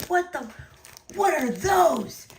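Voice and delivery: freaked-out shriek. Retold or imitated. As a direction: recoil from the water spiders. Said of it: "I was like".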